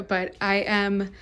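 ordinary talk, nothing marked

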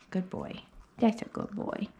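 quiet soothing pet talk; soft praise for the dog. Real, not acted. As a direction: hush him with praise. Softly praising dog on table.

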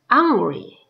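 ordinary talk

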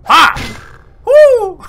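triumphant whoop. Real, not acted. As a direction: whoop and laugh, high and loud. Celebrating a dart hit.

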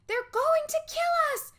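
very high, loud panicked cry; terrified cry. Acted. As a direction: shout it as they close in. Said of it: "Josh cried".

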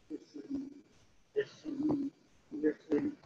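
softly muttering to himself; under his breath. Retold or imitated. Client coaches himself while his therapist talks, murmured under breath. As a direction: prompt yourself almost silently. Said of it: "prompt himself under his breath".

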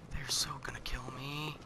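scared whisper under the breath. Real, not acted. Enemies approaching, whispered.

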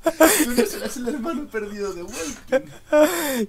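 very high, slow, loud comic voice; silly voice. Acted. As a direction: stretch it out in a squeaky joke voice. Repeating joke in put-on voice.

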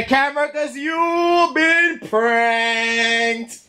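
slow, high triumphant shout; prank reveal shout. Real, not acted.

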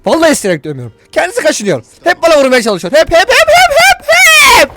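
high, loud hammed-up protest; mock-aggrieved complaint. Tattling on a prisoner to his mother, mock-aggrieved. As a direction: whine loudly, climbing to a frantic squeal.

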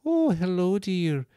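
high, soft Scottish nanny voice. Acted. Bear toy greeting a child, high soft Scottish voice. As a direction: coo it in gentle Scottish. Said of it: "very soft Scottish way of talking".

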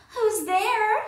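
mock granny voice. Wolf pretending to be Granny.

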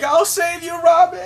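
very high, loud heroic shout; Chopper shouting. Retold or imitated. Chopper runs off to rescue Robin, very high shout. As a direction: yell it as he charges off.

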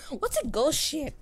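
giggling through the question; laughing disbelief. Real, not acted. Player laughing at the ghost ship twist, giggling.

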